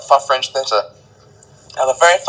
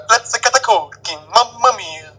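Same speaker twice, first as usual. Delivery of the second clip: higher pitched hammed-up Italian chef accent; 7 semitones higher than usual. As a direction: play a cheesy Italian pizza chef. Italian chef act, pizza, moustache.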